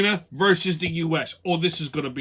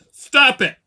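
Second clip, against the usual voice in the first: sharp, louder command; a short, higher warning as Trump would say it; imitating Trump; 6 semitones higher, 10 dB louder than usual. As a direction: bark it like a no-nonsense Trump. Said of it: "Trump would have simply gone".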